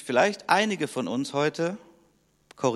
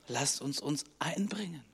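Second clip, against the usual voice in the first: soft, unvoiced whisper; whispered appeal; 10 dB quieter than usual. Real, not acted. The preacher whispers an appeal to the congregation. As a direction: draw them close with a whisper.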